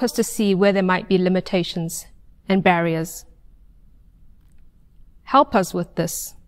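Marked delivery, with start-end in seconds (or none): none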